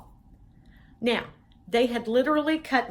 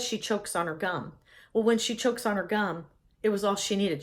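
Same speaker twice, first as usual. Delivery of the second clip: plain reading voice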